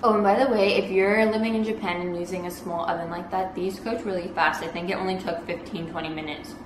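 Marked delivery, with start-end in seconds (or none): none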